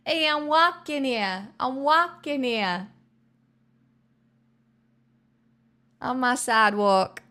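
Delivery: mock-indignant New York accent. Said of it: "But New York is hard for me".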